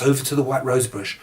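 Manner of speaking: plain reading voice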